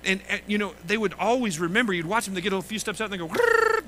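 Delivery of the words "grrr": put-on a playful kid's growl, much higher; growly kid. Dad retelling his little boys' mock growl. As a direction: growl it like a tiny hunter. Kids voiced locking in on dad.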